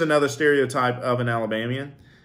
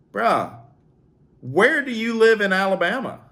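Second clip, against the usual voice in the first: higher, rising disbelieving question; incredulous; 9 semitones higher, 3 dB louder than usual. Real, not acted. Vlogger reacting to a commenter's claim, incredulous. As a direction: scoff at the claim.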